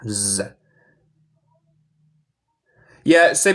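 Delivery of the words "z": a soft buzzing z held alone; z suave y zumbante; buzzed z. Shown as a demonstration. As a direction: hum a quiet z. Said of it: "más como z".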